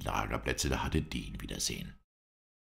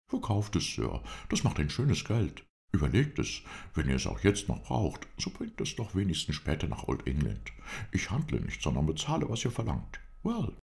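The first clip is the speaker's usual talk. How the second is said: higher-pitched character voice; lively, high-voiced urging; eccentric English gentleman, persuading; 6 semitones higher than usual. A narrator's reading. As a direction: press him eagerly to sell.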